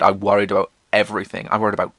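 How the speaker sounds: ordinary talk, nothing marked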